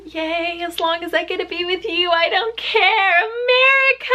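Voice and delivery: mock gushing, building to a high squealed cheer; mock contestants. Mocking the girls' faces when Chris promised travel, building squeal. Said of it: "every girl's face was like".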